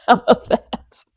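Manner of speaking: ordinary talk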